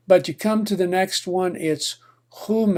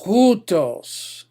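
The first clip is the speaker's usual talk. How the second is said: hutos stressed on HU; hutos, HU raised and stressed; stress on first syllable; 4 semitones higher than usual. Shown as a demonstration. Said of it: "you put the stress".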